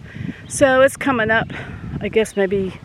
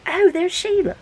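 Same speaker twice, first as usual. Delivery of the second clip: high, delighted squeal of recognition; light up like you just spotted a friend; excited recognition; 10 semitones higher than usual. Pretend. Viewer spotting Sheila's new video, excited high greeting.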